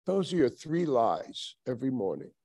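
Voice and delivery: ordinary talk, nothing marked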